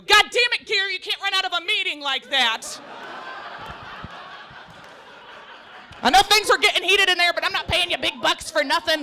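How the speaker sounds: mock angry boss. Boss scolding dog, loud.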